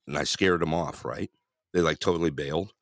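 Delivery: ordinary talk, nothing marked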